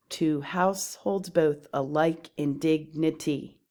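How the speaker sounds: even iambic beat, HOUSE, BOTH, LIKE, DIG stressed; metrical stress. Shown as a demonstration. Teacher scanning an example line, alternating unstressed and stressed syllables. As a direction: scan "Two households both alike in dignity" beat by beat. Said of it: "mark up the scansion of these examples".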